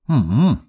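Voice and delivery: drawn-out knowing hum; meaningful hum. Acted. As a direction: hum it as if it means a lot. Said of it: "многозначительно промычал".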